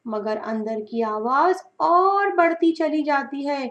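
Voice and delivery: ordinary delivery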